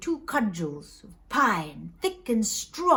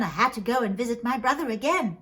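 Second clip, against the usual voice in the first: plain reading voice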